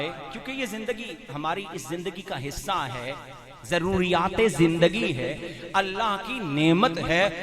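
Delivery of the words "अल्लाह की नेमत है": ordinary talk, nothing marked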